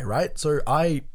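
normal talking voice

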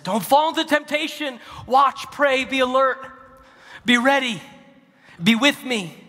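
high, loud urging; urgent command. Acted. Preacher voicing Jesus warning the disciples, urgent loud command. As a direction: call out the warning, high and forceful.

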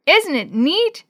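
high, loud call; shouted over the noise; excited calling out. A narrator's reading. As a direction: call it gleefully to a friend. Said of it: "Dee Dee called to Willie".

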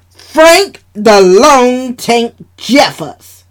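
slow, loud, drawn-out reveal; sly announcement. Acted. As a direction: announce it slyly. Sheila announcing with wink.

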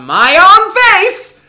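very high, very loud, slow squeal; laughing shriek. Real, not acted. Storyteller confessing his psychopath move, incredulous laughing shriek. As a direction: shriek each word through laughter. Cracks up confessing the crazy lie.

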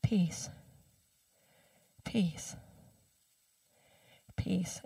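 whispered mantra, very soft and slow; hushed chant of the mantra. Shown as a demonstration. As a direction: breathe the word out like a meditation mantra. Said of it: "easy to use as a mantra".

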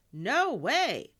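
high, loud shout of refusal. A narrator's reading. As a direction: shout it down hard. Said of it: "Elena shouted".

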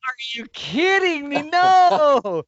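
high, loud exasperated cry; stunned disbelief. Retold or imitated. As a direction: react like you can't believe the miss. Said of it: "And I was like".

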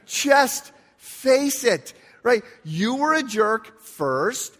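high, slow, drawn-out mock pleading; hammed-up exasperation, stretching each phrase; exasperated retort. Acted retort to yelling friend.

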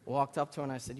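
ordinary talk

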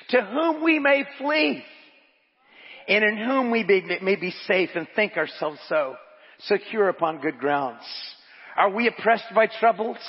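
preaching. Acted. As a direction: preach the quotation with building conviction.

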